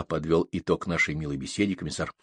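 ordinary delivery

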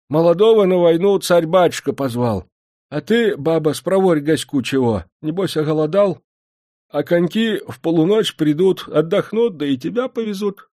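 put-on high, meek old man's voice; old postmaster. A narrator's reading. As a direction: answer like a frail old man.

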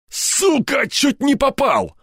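high-pitched howl of alarm. A narrator's reading. Степаныч was just shot at, howling. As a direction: wail the curse, strained and high. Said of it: "взвыл Степаныч".

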